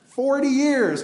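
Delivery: forty years, called out high and loud; emphatic preaching. Acted. Preacher to the flock, driving home forty years, loud and high. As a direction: hammer it home to the congregation.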